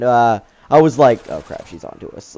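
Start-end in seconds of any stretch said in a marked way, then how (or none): 1.3-2.3 hushed, breathy aside under the breath; realizing the clerk suspects them, whispered in panic; panicked whisper. Retold or imitated. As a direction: whisper it so she can't hear. I didn't say that in front of her.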